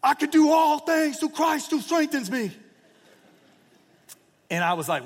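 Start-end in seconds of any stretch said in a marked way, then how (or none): 0.0-2.6 high, loud yelled battle cry; screaming at the top of his lungs. Retold or imitated. Verron yelling to the dark locker room, high loud scream. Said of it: "Verron screams at the top of his lungs".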